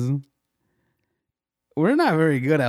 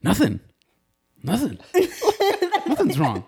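laughing, pitched way up, insisting; high squeaky denial. Retold or imitated. Mocking Keith's denial, squeaky high voice through laughs. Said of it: "it'd be more like".

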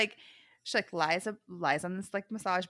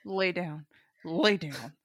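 low order through clenched teeth; restrained parental command, retold; 5 semitones lower, slower than usual. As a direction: say it tight-jawed, like to a toddler. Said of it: "Like, clenched teeth".

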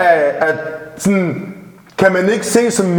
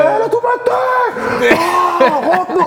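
very high, frantic mock cry; retold panicked yelling; faster than usual. Driver reenacting panic over the unconscious woman, high mock yell. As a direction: act out panicking over someone hurt.

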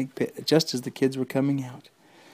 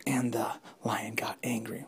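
hushed whisper; suspense whisper; 2 semitones lower, 8 dB quieter than usual. A narrator's reading. Storyteller whispers as the lion gets free.

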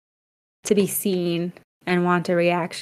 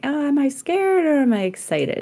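higher, playful self-questioning; switching to curiosity; 8 semitones higher than usual. Retold or imitated. Artist reframing her own fear of sharing art, playful wondering. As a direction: ask yourself lightly, pitched well up. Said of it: "then I can kind of switch it".

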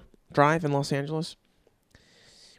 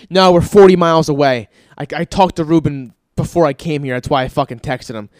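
annoyed retort; 8 dB louder than usual. Retold or imitated. Arguing with the salesman.

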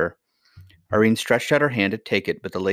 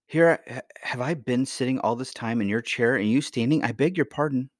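put-on higher-pitched, flustered child; apologetic young princess; 5 semitones higher than usual. Princess sitting while grandmother stands, apologetic. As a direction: stumble into a girlish apology.

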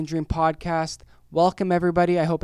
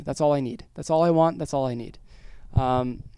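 usual speaking voice

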